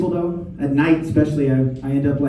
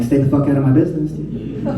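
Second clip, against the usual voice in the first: fast mock irritation. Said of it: "I'm like".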